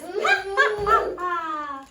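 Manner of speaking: loud, hammed-up ha-ha laugh; big storybook laugh, starting high; acted laugh. A narrator's reading. Funny dwarf laughs at the visitor, hammed-up laughing. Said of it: "The funny dwarf laughs".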